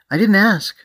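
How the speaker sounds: loud, high-pitched huffy retort; irritated rebuff. Acted. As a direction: shut down an unwanted introduction sharply. Character rebuffs an introduction.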